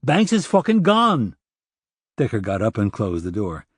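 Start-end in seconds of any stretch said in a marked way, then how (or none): 0.0-1.4 high, slow, furious yell. Acted. O'Dell yelling the news at Decker, furious. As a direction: spit each word out in a rage. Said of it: "he yelled".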